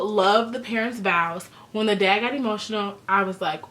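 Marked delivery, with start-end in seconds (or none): none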